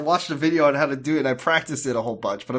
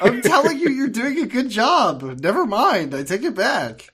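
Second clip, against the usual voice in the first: laughing; 11 semitones higher, 6 dB louder than usual. Real, not acted. Teasing co-host about duck voice.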